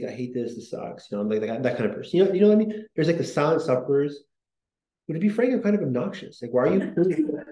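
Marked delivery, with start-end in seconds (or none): 0.0-1.1 soft, fading whine trailing to a breath; a sick man's soft self-pitying grumble; whiny sick complaint. Retold or imitated.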